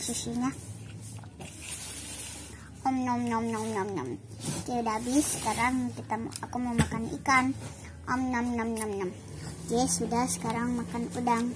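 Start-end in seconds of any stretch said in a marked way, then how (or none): 2.8-4.1 playful pretend munching; nom nom chomping. Pretend eating toy food. 8.0-9.1 playful pretend munching; nom nom chomping. Pretend eating toy food.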